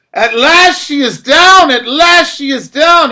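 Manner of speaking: high, loud, slow triumphant cry. A narrator's reading. Absil crying out as the wall falls, triumphant. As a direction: shout that it's finally over. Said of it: "he cried out".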